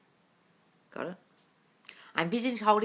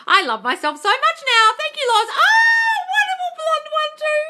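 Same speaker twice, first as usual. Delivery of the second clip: much higher and louder, shouting; shrieking posh gush; 9 dB louder than usual. Pretend. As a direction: squeal with delight. Gushing over viewers' comments.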